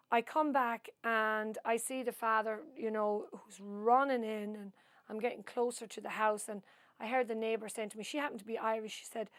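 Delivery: ordinary talk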